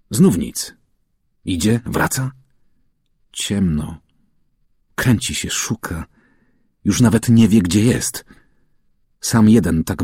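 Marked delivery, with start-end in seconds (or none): none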